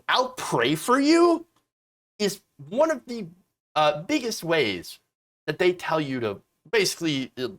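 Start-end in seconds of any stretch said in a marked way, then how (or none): 0.1-1.4 mock pious.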